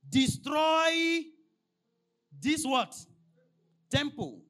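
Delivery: a preacher voicing Jesus calling out Destroy this temple; Jesus's command. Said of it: "And Jesus said unto them".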